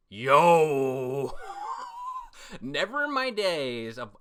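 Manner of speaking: loud shout breaking into laughter; real hype, laughing out loud; excited laughing yell; hyped shout. Real, not acted. Hyped reaction to manga twist.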